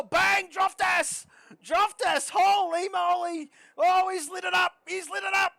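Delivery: loud, high, thrilled race call; excited shout. Real, not acted. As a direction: erupt as the lap lights up. Live lap call erupts.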